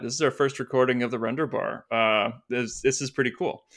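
ordinary talk

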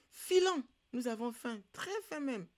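high, urgent plea to go; urging to leave. Acted. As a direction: press the others to get moving. Animals urge leaving, hungry.

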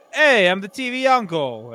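a hammed-up sitcom charmer introducing himself; mock TV uncle. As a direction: ham up a smooth sitcom uncle. Imitating John Stamos's TV persona.

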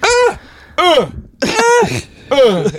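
high grunting moans; tennis grunts. Retold or imitated.

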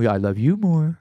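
teasing sweet talk. Pretend. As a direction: sing it back brightly. Playing lovey phone call.

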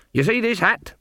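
high, insistent question; demanding. A narrator's reading. Granny confronting the innkeeper about her hat, demanding. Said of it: "she demanded".

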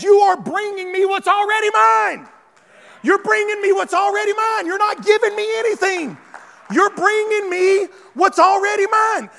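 high, loud preacher shouting God's words; full-force preaching. Acted. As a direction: thunder it out to the congregation.

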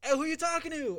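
high-pitched imitation of someone butting in; voice in background. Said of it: "in the background, you just hear".

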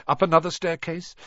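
ordinary delivery

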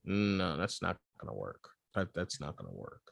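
quiet, low, deflated inner verdict; muttered to himself. Retold or imitated. Host recalling his first reaction to the actor's accent, muttered softly. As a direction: mumble it under your breath like a private groan. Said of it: "I was like".

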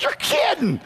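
high hammed-up gasp of disbelief; squeaky mock outrage. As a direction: act stunned at the price. Retold shock at the bill.

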